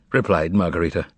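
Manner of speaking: ordinary delivery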